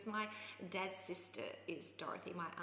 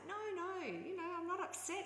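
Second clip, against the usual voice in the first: reassuring; 10 semitones higher than usual. Retold or imitated. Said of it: "I was like".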